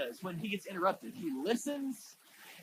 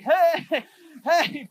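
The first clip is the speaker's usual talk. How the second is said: eager calling out; 6 semitones higher, 11 dB louder than usual. Retold or imitated. Peter calls to Jesus.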